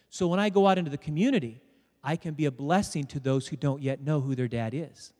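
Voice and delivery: normal talking voice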